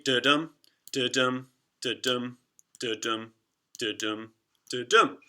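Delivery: rhythmic da-DUM, stress on dum; iambic beat da-dum. Shown as a demonstration. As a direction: tap out da-dum like footsteps. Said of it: "when you hear footsteps, you hear".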